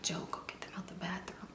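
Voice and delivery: urgent hushed whisper. Retold or imitated. Telling Joe to go get them out, whispered. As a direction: whisper it so the others don't hear.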